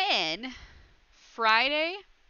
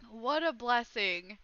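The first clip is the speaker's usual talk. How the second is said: ordinary talk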